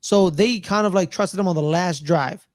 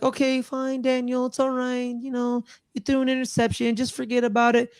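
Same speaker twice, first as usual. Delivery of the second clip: mock easygoing acceptance; 5 semitones higher than usual. Coach excusing a thrown interception, mock soothing. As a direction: imitate a coach shrugging off a mistake. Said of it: "like, just be like".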